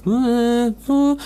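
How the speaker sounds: high-pitched mock reply; the other tipster. Two partners swapping tips, high acted reply. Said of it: "Und der andere sagt".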